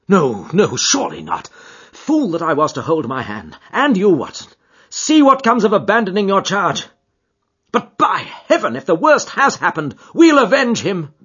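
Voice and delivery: loud, high, bitter outburst; anguished fury. A narrator's reading. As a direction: rage in self-reproach, building to a vow. Holmes's outburst of self-blame and vengeance.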